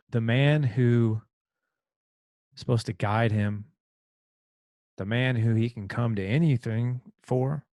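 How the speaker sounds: real emotion catching in the voice; struggling to get the words out, moved; getting emotional. Real, not acted. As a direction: push through, choked up, slowing at the end. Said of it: "I apologize if I'm getting a little emotional".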